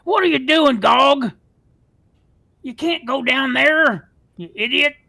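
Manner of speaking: loud high scolding yell; angry ogre shout. Acted. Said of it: "this ogre jumps down".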